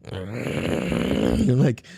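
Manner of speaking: low, drawn-out mock growl; seething growl. Mimicking the furious old lady, seething growl.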